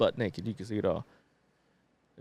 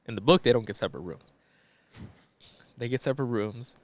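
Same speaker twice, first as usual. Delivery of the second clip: normal talking voice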